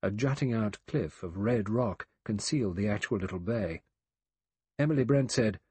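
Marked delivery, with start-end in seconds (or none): none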